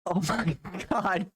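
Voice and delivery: exasperated laughter; laughing. Real, not acted. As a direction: laugh through disbelief.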